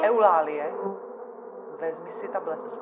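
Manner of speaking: loud, stern call of her name; firm call. Acted. Doctor cuts off rambling patient Eulálie with a loud call. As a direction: cut her off sharply by name.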